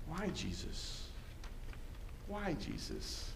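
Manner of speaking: very soft, slow question; hushed pleading. Real, not acted. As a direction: ask it almost under your breath, slowly. Hushed anguished question to Jesus.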